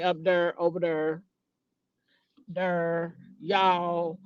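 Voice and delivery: lightly imitating a Frederick, Maryland accent. Vlogger teasing her niece's Frederick, Maryland accent. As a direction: tease the niece's Frederick accent on "there" and "y'all". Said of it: "they got a little accent where she from".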